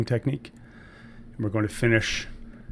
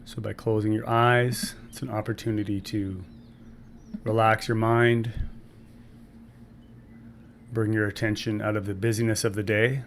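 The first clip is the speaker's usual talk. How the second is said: calm breathwork guide with long pauses between phrases; measured meditation-teacher voice, phrase by phrase; guided meditation. Acted. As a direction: ease listeners into the breath, unhurried and even.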